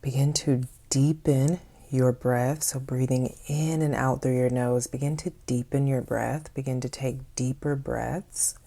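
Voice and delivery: unhurried meditation voice, long pauses between phrases; guided meditation. Acted. Host leading listeners to notice their breathing, guided meditation voice. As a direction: guide them calmly into the breath, leaving space after each phrase.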